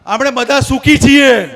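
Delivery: slow, loud crowd-leading declaration; loud affirmation. Shown as a demonstration. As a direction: proclaim it for the crowd to answer. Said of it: "જોરથી બોલજો, જેટલી તાકાત હોય એટલી".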